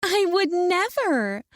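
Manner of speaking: high, slow mocking imitation of another woman; mock her in a high, prissy voice; mocking shrill mimic. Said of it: "I mimic her shrill tone".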